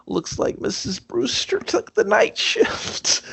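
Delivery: high breathy whisper of a creepy line; whispered movie line. Retold or imitated. Villain whispers a creepy taunt to Vincent. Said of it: "He's like".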